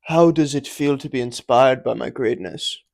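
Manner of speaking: loud, grandiose mock-motivational voice; inspirational intro. Host auditioning intros for viewers, loud and hammed-up inspirational. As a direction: boast it like a self-important guru. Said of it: "which one of those you think was the most inspirational".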